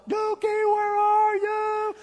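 high, loud, slow call. Retold or imitated. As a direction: sing it out across the neighborhood. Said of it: "you can hear calls of".

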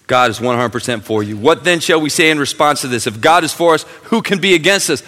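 loud, high fired-up pulpit voice; full-force preaching. Acted. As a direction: preach it out to the whole room.

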